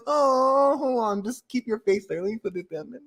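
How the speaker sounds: mock soothing, sneaky patter; slick fake comfort. Host slyly collecting a crier's tears, fake soothing. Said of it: "be mad slick, just be like".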